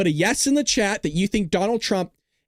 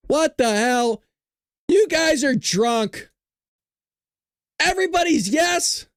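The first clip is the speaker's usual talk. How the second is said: outraged disbelief; 9 semitones higher, slower than usual. Real, not acted. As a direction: scold the chat in mock shock. Outraged at poll result.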